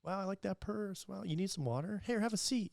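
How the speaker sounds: high, sugary, fawning voice; catering sweetly to the wife in a high voice. Retold or imitated. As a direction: gush over her like a charming host. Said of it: "talked to them like".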